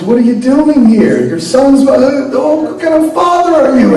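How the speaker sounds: high, loud laughing rant; yelling mother. Acted. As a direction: tear into the father, half laughing. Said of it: "she yells at him".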